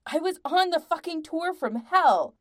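loud, high, hot retort; heated retort. A narrator's reading. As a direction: fire back angrily. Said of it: "Patrick returned hotly".

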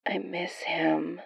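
soft, slow, barely audible; almost too soft to catch. Acted. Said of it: "his voice so soft".

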